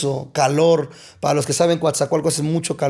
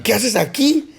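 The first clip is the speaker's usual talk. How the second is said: startled; 7 semitones higher than usual. Retold or imitated. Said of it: "Y me espantó".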